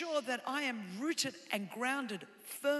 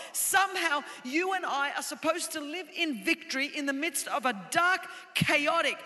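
loud, driving sermon delivery; preaching; 4 semitones higher, 8 dB louder than usual. Real, not acted. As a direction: preach it with rising urgency to the congregation. Preaches at full force.

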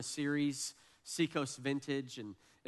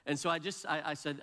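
ordinary talk

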